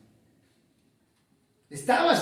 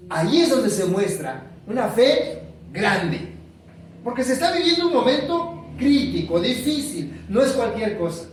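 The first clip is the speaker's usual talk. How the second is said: loud, higher driving delivery; emphatic preaching; 6 semitones higher, 4 dB louder than usual. Acted. Preacher stressing worship in hard times, emphatic. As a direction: hammer the point home.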